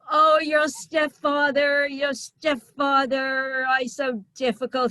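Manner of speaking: high, loud, slow whiny mother; complaining mother.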